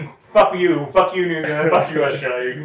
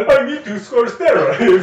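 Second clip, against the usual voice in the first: much higher, slow and louder in a hammed-up Russian accent; mock Russian accent; 8 semitones higher, 5 dB louder, slower than usual. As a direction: ham up a thick Russian accent. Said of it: "He's not Russian".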